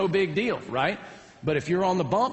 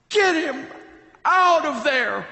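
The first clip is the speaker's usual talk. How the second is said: high, loud, drawn-out yell; yelling parents; 10 semitones higher, 6 dB louder, slower than usual. Retold or imitated. Parents yelling at coaches to pull the pitcher, acted yell. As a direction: holler it slowly from the stands. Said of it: "every parent on both sides are yelling out".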